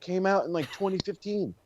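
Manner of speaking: usual speaking voice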